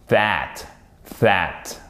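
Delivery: THAT started with a voiced TH; hard TH. Shown as a demonstration. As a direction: say THAT low and alone, buzzing the TH. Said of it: "c'est un hard T H".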